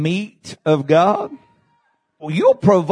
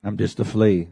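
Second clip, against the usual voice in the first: much lower and softer, inward; quiet echo; 8 semitones lower, 5 dB quieter than usual. Acted. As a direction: drop it to a hush. Quiet echo after shout.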